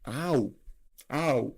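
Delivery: sharp ow of real pain. Real, not acted. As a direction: yelp of pain after biting tongue. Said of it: "I bit my tongue".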